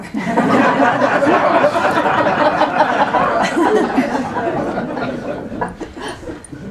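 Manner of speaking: a long breathy laugh at her own joke; high airy laugh. Real, not acted.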